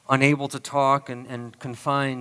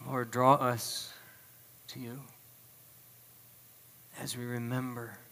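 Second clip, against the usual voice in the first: solemn prayer. Real, not acted. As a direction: lead them in prayer unhurriedly, softening at the close. Leads the congregation in prayer.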